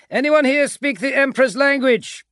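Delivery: high, loud bellow. A narrator's reading. Agapito questions the captured command staff, bellowing. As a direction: call out to the whole room. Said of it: "he bellowed".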